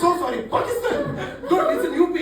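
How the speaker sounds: high, loud comic back-and-forth; hammed-up squabble; exasperated argument. Acted argument over where Banaras is.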